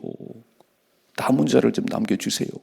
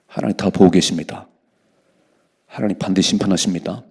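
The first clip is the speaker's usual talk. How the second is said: ordinary talk, nothing marked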